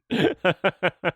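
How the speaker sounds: short, high burst of laughter; amused laugh. Real, not acted. Reacting to a show's dubious accent, high amused laugh. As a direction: break into a real high giggle.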